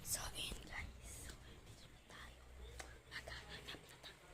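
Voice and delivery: hushed whisper while searching; whispering while hiding. Real, not acted. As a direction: whisper so they can't hear. (pabulong habang naghahanap)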